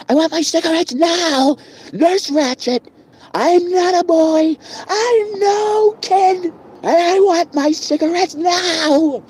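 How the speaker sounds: a frantic high-pitched plea for cigarettes; Charlie Cheswick. Retold or imitated. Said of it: "Charlie Cheswick".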